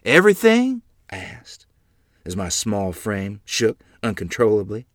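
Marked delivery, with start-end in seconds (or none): 0.0-0.8 high, loud, alarmed question; frightened disbelief. Acted. Child to father before a whipping, alarmed. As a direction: ask it with rising dread.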